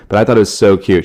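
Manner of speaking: ordinary delivery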